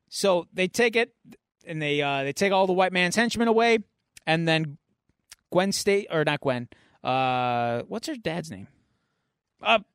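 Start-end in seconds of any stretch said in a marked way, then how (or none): none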